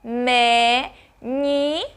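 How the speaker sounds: "menino" very slowly as me, ni, no, long pauses between; very slow syllables. Shown as a demonstration. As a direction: stretch and separate each syllable of "menino". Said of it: "bem devagarzinho". ("menino" bem devagarzinho, sílaba por sílaba)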